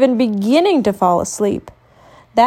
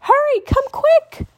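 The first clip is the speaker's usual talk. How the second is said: very high frightened call for parents; panicked little cub calling out; scared cry. Acted. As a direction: cry out for Mama and Papa in fright. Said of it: "she cried".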